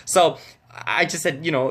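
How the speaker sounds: normal talking voice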